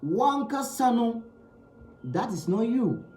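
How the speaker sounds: normal talking voice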